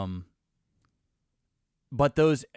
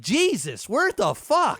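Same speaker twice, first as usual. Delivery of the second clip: shocked; 9 semitones higher, 3 dB louder, slower than usual. Retold or imitated. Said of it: "It's like".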